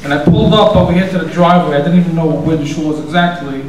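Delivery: ordinary talk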